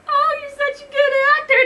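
loud, fast, high-pitched fangirl voice; gushing lady. A narrator's reading. Starstruck lady praising her supposed idol, gushing squeal. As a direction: gush in a piercingly high voice. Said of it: "the lady said".

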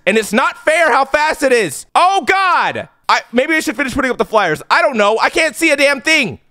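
ordinary talk

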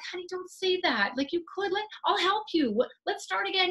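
high, hurried fix-it reassurance; coaxing parent. Acted. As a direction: jump in to fix it. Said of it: "what we normally say is something like".

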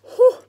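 sharp high exhale at the fizz; high loud whew. Real, not acted. Fizz overwhelms her.

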